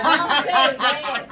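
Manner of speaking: loud, slow, through laughter; laughing protest. Real, not acted. Guest laughing at her co-host's joke, loud and slow. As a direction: cry out while cracking up.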